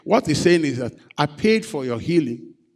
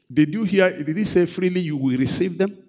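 ordinary delivery

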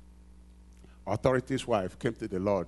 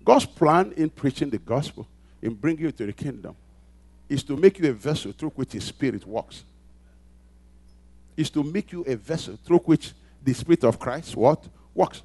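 loud, high, driving pulpit voice; preaching at full force; 7 semitones higher, 16 dB louder than usual. Acted. Pastor rousing the congregation, preaching at full force. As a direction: build it up and hammer each phrase.

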